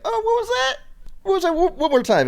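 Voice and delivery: very high-pitched startled double take; mock astonishment. Podcaster re-enacts hearing A C while taking notes, high mock surprise. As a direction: squeal it in exaggerated disbelief.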